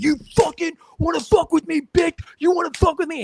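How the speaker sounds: high shouted imitation of a brawler; mock furious yell. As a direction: yell it like a guy mid-punch. Attacker cursing while punching.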